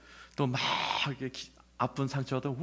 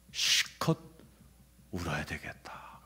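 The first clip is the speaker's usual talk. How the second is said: whispered inner resolve, much softer; hushed whisper; 9 semitones lower, 5 dB quieter, slower than usual. Retold or imitated. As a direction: breathe it to yourself like a private decision. Quoting tape, inner resolve.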